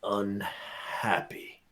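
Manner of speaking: word drawn out very slowly and softly; slow, hushed elf complaint; grumpy elf. Acted. Old elf leans forward to the party, slow and unhappy. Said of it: "he leans forward and he just goes".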